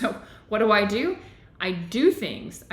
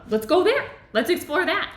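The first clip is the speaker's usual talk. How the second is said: higher-pitched, keen rallying of oneself; eager self-talk; 8 semitones higher, 4 dB louder than usual. Acted. Teacher voicing her response to a trigger, eager. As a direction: urge yourself on with lifted energy.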